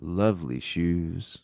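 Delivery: low, slow compliment; deep, unhurried acted voice; soothing voice. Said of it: "he heard a soothing voice say".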